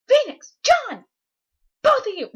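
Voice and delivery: high, loud exasperated scolding; stern calling out. Retold or imitated. Principal scolds two students in the library, stern shout. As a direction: shout both names like a fed-up principal.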